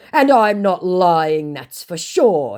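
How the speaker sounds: high, loud, slow denial; drawn-out mock-earnest protest; mock scammer. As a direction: insist slowly as the scammer. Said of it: "he said".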